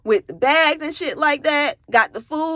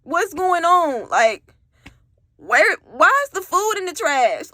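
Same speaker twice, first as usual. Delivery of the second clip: baffled what's going on; 7 semitones higher than usual. Retold or imitated. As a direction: draw it out high, stunned. Asks why food was binned.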